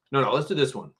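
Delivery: normal talking voice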